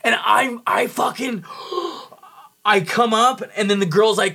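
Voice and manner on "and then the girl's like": ordinary talk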